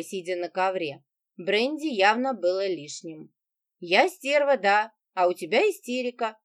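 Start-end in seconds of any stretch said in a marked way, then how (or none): none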